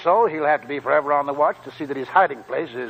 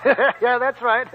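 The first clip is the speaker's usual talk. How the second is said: laughing; 8 semitones higher, much slower than usual. Acted. Old man laughs at Dobbs.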